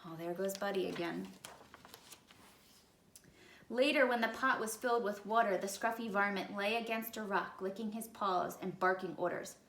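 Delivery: usual speaking voice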